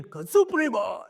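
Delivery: slow loud drawn-out call. Retold or imitated. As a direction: bellow the name across the room. Instructor calls out trainees.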